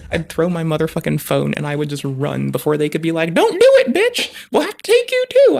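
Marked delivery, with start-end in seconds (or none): 3.4-5.6 squeaky, much higher and louder mock yell; shrill mock bystander shout. Mocking bystanders who yell at her to stop, in a shrill squeaky shout. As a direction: screech it like a panicked onlooker.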